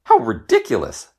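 high, sniffy princess scoff; haughty inner scoff; scornful princess. A narrator's reading. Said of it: "thought the princess".

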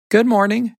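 high-pitched hen greeting; cheery hen character. A narrator's reading. Little Red Hen greeting Ms. Cow, high character voice.

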